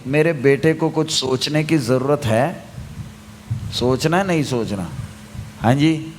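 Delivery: ordinary talk, nothing marked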